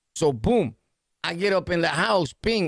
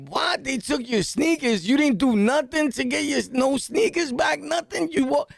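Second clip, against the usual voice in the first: higher, indignant disbelief; 7 semitones higher than usual. Retold or imitated. Narrator angry his friend got robbed, indignant higher voice. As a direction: sound heated and offended. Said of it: "I'm tight".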